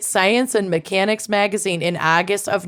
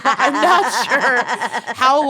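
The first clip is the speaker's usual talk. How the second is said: laughing; 4 semitones higher, much slower than usual. Real, not acted. Laughing while speaking.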